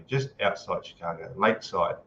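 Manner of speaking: ordinary talk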